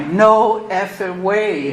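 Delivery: slow, loud, stunned disbelief; drawn out and loud like you can't believe it. Retold or imitated. Telling her friend the news can't be true, slow stunned disbelief. Stunned reaction to parole approval.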